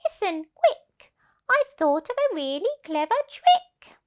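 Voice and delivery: high, urgent little voice; whisper-urgent excitement; eager ladybird. Acted. Ladybird telling the animals her plan, high and eager. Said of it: "the ladybird said".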